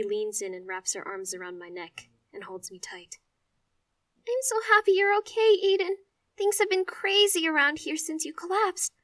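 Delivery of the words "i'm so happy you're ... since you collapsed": high, loud relieved crying; tearful relief. Acted. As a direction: hug him and sob with relief. Sister hugging him, relieved.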